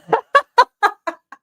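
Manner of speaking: loud, high cackling laugh; burst of laughter. Real, not acted. Laughing at the clip.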